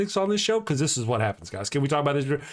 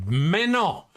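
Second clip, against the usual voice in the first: French; 5 semitones higher than usual. Shown as a demonstration. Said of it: "which is French for".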